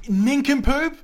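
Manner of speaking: loud high insult; mock outraged yell. Quiz host insulting contestant.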